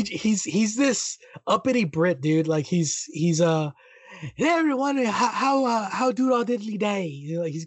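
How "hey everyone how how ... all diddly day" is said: put-on posh British accent. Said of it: "very posh British accent".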